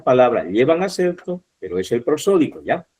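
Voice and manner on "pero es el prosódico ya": ordinary talk, nothing marked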